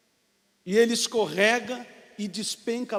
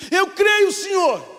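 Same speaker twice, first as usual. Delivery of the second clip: higher, louder fervent reply; eager declaration; 9 semitones higher, 8 dB louder, slower than usual. Acted. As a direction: blurt out faith eagerly. Climber answers God.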